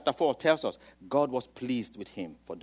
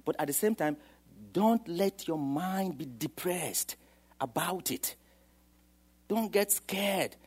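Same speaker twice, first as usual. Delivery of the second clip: normal talking voice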